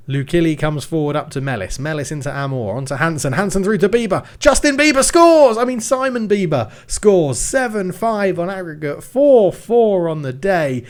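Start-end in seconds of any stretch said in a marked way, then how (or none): 3.3-10.8 high, loud goal shout; excited commentary. Real, not acted. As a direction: yell it as it goes in.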